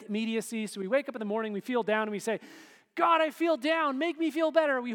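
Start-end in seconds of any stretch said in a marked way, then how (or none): 3.0-4.8 high, loud, impatient plea to God; hammed-up demanding prayer, higher and louder; whiny mock prayer. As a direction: whine it out like a needy kid demanding results now.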